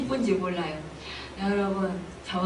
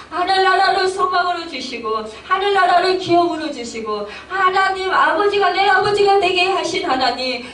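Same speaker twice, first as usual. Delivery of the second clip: loud, high, mounting prayer; fervent praise prayer; 7 semitones higher, 5 dB louder than usual. Acted. Soul rejoicing in God who saved it, fervent prayer. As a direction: cry praise to God at full force.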